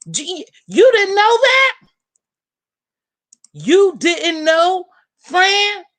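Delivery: high, loud, drawn-out disbelief; incredulous surprise. Real, not acted. To her friend Fran, high incredulous astonishment. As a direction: sound amazed she never knew. Shocked friend doesn't know.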